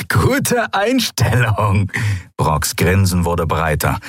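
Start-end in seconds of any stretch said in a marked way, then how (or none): 0.1-1.9 high, slow, relishing the choice; cruel, amused creature voice; gloating lizard thug. A narrator's reading. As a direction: taunt the prisoners playfully. Draxyl mocks Keru.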